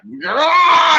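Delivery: big whooping yell, way up high; excited yell. Retold or imitated. Demo guy whooping at a hyped rider. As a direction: whoop it up at the customer.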